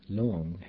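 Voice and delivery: Lung with an oo vowel; U as in scuba. Shown as a demonstration. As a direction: say Lung with the u of "scuba", unlike the organ. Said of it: "but with an U sound".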